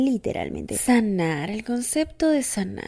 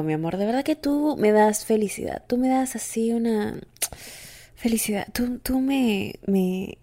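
ordinary delivery

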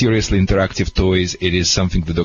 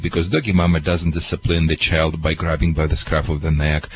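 normal talking voice

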